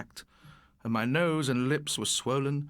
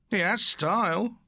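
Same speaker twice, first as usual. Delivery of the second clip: slow, raised mocking call; calling out mockingly; 6 semitones higher than usual. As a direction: show off to the mates, drawn out. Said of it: "Morris call to his guffawing mates".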